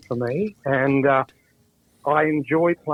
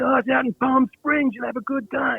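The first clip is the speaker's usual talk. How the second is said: coaxing waiter; 10 semitones higher than usual. Retold or imitated. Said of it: "He said".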